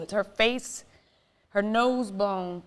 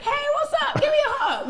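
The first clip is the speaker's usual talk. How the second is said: high, loud, excited hello with a laugh; gushing love-bug greeting; 8 dB louder, faster than usual. Acted. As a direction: greet them like a hug-happy flower child. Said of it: "So I like to be like".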